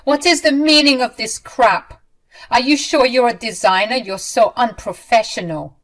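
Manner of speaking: loud, high angry demand; agitated, demanding. Acted. Mother-in-law bursting in, loud and angry. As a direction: storm in and demand answers. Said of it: "agitated and demanding answers".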